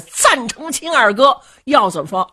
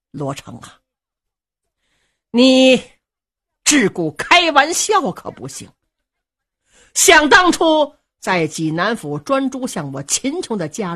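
stern scolding; 3 semitones higher, 4 dB louder than usual. Acted. Elder cousin warning the young marshal, stern loud scolding. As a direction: lay down the law to him.

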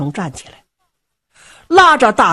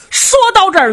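plain reading voice

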